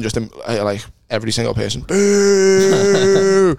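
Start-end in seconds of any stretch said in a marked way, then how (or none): 1.8-3.6 crowd boo. Retold or imitated. Crowd booing retold.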